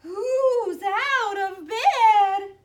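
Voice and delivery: very slow, high, wailing monster; eerie drawn-out howl; icy monster call. A narrator's reading. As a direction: wail it out like a ghost, very loud. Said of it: "an icy voice called".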